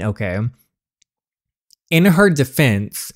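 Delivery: ordinary delivery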